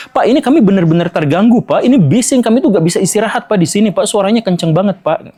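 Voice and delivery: rude high-toned complaint. Retold or imitated. Relative complaining to the ustadz about the mosque noise, rude and high-pitched. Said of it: "Dengan nada yang sangat tinggi".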